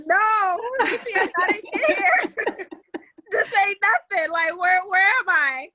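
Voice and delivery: mock panic at being lost in the dark; panicked, lost. Lost driver panicking to herself on the dark road, high and frantic. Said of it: "I was like".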